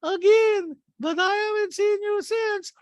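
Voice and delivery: high, loud put-on Diana voice; Diana impression. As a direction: chirp it up high like a prissy socialite. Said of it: "Diana's like".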